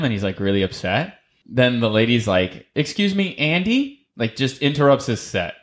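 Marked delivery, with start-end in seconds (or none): 2.7-4.0 higher prim host voice cutting in; imitating the host, pitched up; mock polite interruption. Host interrupts Andy's set.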